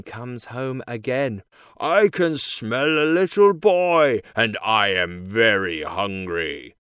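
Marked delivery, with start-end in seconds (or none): 1.8-6.8 giant's shout. A narrator's reading. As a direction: boom it out as the hungry big man. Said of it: "he shouts".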